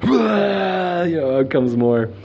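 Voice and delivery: words ride a high laugh. Real, not acted. Recounting the purge to viewers, laughing. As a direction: laugh as it all comes out.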